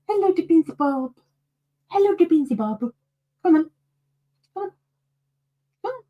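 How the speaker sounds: high, sing-song hello to a pet; cooing pet voice. Real, not acted. Greeting her cat Beansy Bob, high cooing pet voice. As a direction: coo a greeting at your cat.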